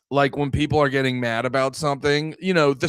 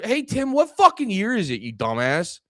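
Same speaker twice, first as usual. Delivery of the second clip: mock telling-off; 7 semitones higher than usual. Telling off Tim Walz, a pitched-up mock scold. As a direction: scold him like he's behind the times. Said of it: "you're like".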